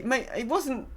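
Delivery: ordinary talk, nothing marked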